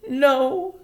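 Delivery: high, subdued reply; a tearful mother answering; Homily voice. A narrator's reading.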